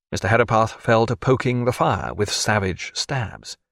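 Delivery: ordinary delivery, nothing marked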